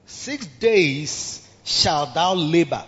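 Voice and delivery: slow, measured scripture voice; solemn scripture reading. Acted. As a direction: read it slowly like holy writ.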